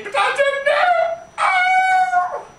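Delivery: high panicked shriek; panicked wail. Acted. Prissy in a parody, shrieking high in panic.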